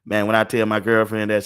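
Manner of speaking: normal talking voice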